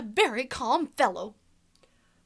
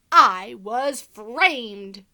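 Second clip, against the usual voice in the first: dramatic; 2 semitones lower, 10 dB louder, much slower than usual. Acted. Wolf's dramatic claim.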